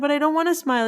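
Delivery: high-pitched, mildly annoyed protest; retold stubborn refusal.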